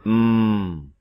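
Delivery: a single steady hummed "Hmm" held long; one voiced m sound, hummed steady; hummed m demo. Shown as a demonstration. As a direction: hold one closed-lip "Hmm", felt in the throat. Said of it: "satu bunyi saja, bunyi tunggal".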